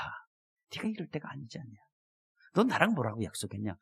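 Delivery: hushed, low-pitched inner voice; quiet reproach. Retold or imitated. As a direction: softly remind someone of a broken promise. Voices God's inner reminder to him.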